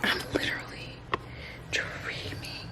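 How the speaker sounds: hushed, breathless whisper of disbelief; stunned whisper. Real, not acted. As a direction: whisper it as if you can't believe your eyes. Still in shock after the test.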